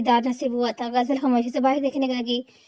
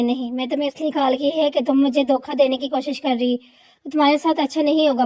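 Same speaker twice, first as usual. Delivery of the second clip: ordinary delivery, nothing marked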